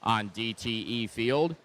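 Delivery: lively live match call; play-by-play commentary. Acted. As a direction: call the action as it happens.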